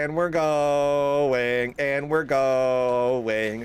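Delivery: very slow, stretched sing-song; slow, mock-ominous chant; drawn-out mock dread. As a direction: draw every word out eerily.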